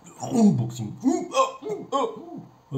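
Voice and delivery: hammed-up German accent, high and strained; bad German accent. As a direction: fake a clumsy German accent, pitch way up. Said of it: "C'est mon accent allemand qui va pas du tout". (faux accent allemand raté, très aigu)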